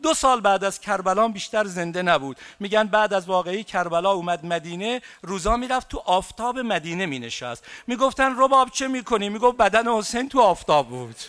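high, loud, tearful mourning cry; preacher's climactic lament for Rabab; wailing lament. Acted. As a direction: let the grief break out in the voice.

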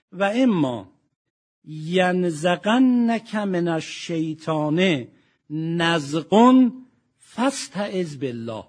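slow, drawn-out chanted recitation; Quranic recitation. Acted. Preacher reciting the verse to the congregation, slow chant. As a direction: intone the verse slowly, stretching each word.

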